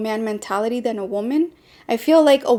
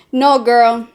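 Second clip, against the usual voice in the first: ordinary talk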